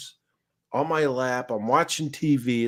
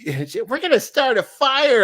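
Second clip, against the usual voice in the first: light wife impression; 13 semitones higher, 4 dB louder than usual. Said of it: "quoting wife".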